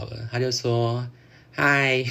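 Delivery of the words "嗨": a slightly higher, smug mock greeting; mimicking an annoying friend's casual hello; imitating his cocky hi. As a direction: toss off a breezy, cocky hi like him. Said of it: "学他很机车".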